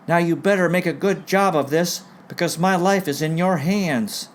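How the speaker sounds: high, insistent admonishing voice; retold warning. Patient warning the surgeon before the operation, high and insistent.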